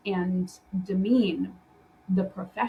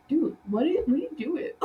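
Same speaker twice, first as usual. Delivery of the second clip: higher-pitched baffled teammate; mock disbelief; 6 semitones higher, faster than usual. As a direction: play a dumbfounded coworker calling it out. Acts out skit's baffled teammates.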